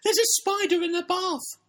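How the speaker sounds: very high, loud squealing voice; hammed-up falsetto alarm; panicked girl's cry. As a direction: shriek it as a frightened little character. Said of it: "cried Emily".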